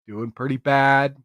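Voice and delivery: normal talking voice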